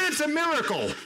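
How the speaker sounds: very high-pitched, fervent cry; preacher's climax, pitch soaring; exultant exclamation. Real, not acted. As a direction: proclaim it at the peak of excitement. Sermon climax, then repeated calmly.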